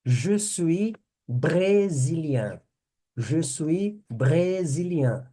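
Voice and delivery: Je suis brésilien slow and clear; teacher modelling for repetition. Shown as a demonstration. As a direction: say it slowly for learners to repeat. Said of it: "eu vou repetir".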